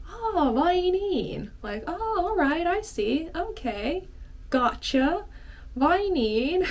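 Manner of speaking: pleased. Shown as a demonstration. Teacher demos a positive reply to good hockey news. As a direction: react with happy interest. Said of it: "doesn't necessarily have to be so kind of negative".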